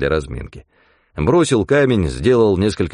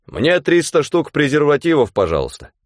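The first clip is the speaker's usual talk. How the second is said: loud, high, bold demand; loud request; 7 semitones higher than usual. Acted. Mitka loudly orders at the pharmacy counter. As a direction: announce it to the whole shop. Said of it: "и громко сказал".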